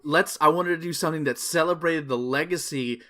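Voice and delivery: normal talking voice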